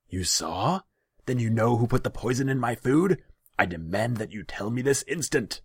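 high, loud, agitated ruler; indignant demand. A narrator's reading. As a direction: demand answers imperiously. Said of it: "You demand it?".

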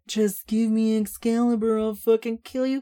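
high, loud sing-song mimicry; mocking parody. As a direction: ham up her threat mockingly. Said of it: "melodramatic bullshit".

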